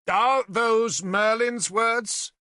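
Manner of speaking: high, loud, slow demand. Acted. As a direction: press him hard for an answer. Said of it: "he demanded of Nimue".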